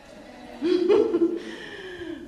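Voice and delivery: high laugh at own joke. Real, not acted.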